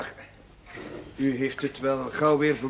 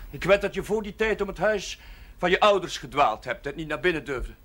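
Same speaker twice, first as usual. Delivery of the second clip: ordinary talk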